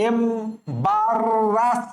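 এমবারাস slowly as em-barr-ras; stretched teaching demo of এমবারাস, beat by beat; drawn-out pronunciation. Shown as a demonstration. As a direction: hold the double r in এমবারাস. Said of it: "উচ্চারণ করো এইভাবে".

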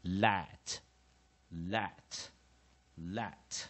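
"let" drawn out slowly with a short vowel; slow pronunciation drill on "let"; short-vowel demonstration. Shown as a demonstration. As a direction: model "let" slowly, pausing between repeats. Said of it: "所以就念".

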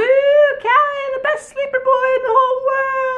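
very high, mock-gushing praise; sarcastic. Real, not acted. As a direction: gush sarcastically, squealing high. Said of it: "I'm totally joking".

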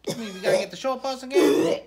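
ordinary talk, nothing marked

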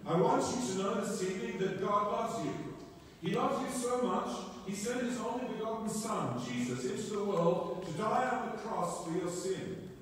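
high, fervent evangelist's appeal; retold preaching. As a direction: preach it to the crowd, lifted high. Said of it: "And what he said was".

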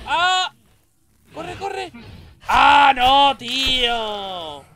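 high loud panicked yells; being chased. Real, not acted. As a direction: shout while running for your life. Fleeing the witch.

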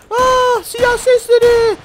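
high, slow, alarmed cry; shocked high voice; startled gasp. Acted. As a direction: recoil at the sound of gunfire. Reacting to gunshots in the robbery.

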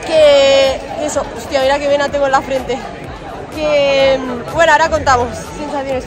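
high, loud, breathless; buzzing right after finishing; excited. Real, not acted. Recién acabada, sin aliento. (emocionada y sin aliento)

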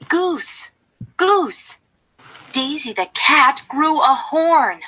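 high, urgent call to Goose; excited calling. Acted. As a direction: blurt the news breathlessly. Duck calls out gossip to goose.

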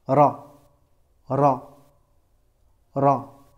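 Ra with a rolled R and full A resonating; Ra, open the A so it resonates in the mouth; emphatic Ra. Shown as a demonstration. Teacher modelling the emphatic letter Ra for the student. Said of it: "Écoute".

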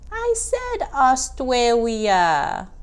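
"Australia" said as "Austwalia", slow and insistent; stubborn little child repeating the word; toddler insisting. Acted. Baby insists.

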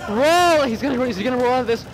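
ordinary delivery

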